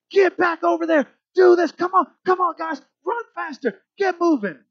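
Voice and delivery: high, loud coach yelling at players; loud coach shouting. Retold or imitated. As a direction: bark orders like a courtside coach. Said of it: "coming alongside in a loud voice".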